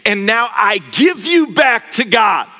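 slow, building to a loud high proclamation; bishop's blessing. Acted. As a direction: bless him slowly, rising to a shout. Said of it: "He says".

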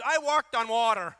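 boastful Peter. Retold or imitated. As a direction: crow it like a proud disciple. Peter's selective memory boast.

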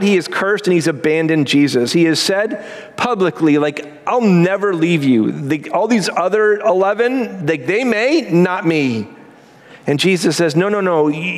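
high, driving preacher voice; fervent preaching. Acted. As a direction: preach it with rising urgency. Retelling Peter's denial.

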